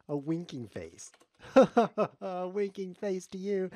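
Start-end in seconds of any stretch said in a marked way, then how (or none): 1.5-3.7 slow, high, gleeful jeer; loud cackling mockery; laughing taunt. Real, not acted.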